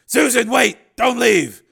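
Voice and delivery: loud, high, desperate shout; urgent calling out. Acted. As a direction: stop her with a desperate shout. Man calls as she leaves.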